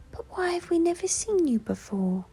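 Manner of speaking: high, curious little bear; Little Bear's voice. A narrator's reading. Little Bear asking the owl, high and curious. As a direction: ask it like a wide-eyed small bear. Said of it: "asked Little Bear".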